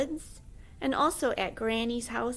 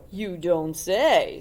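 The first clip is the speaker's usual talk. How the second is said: sly wolf; 2 semitones lower, 7 dB louder, much slower than usual. Acted. The wolf speaks.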